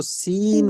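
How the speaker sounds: सीन with a drawn-out ee; stressed long vowel. Shown as a demonstration. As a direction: hold the ee in सीन to show the long sound. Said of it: "stress sound इसी को बोलते हैं".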